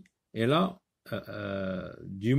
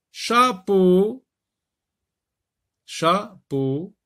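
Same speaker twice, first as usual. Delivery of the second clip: "chapeau" called much higher and louder; emphatic word; 9 semitones higher, 8 dB louder, much slower than usual. Shown as a demonstration. Teacher contrasting chapeau with château for pupils. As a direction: hit "chapeau" loud and high.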